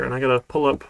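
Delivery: normal talking voice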